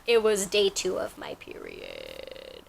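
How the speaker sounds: hushed, breathy confession dropped low; whispered aside. Real, not acted. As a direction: lean in and whisper it like a secret. Embarrassed aside about her period.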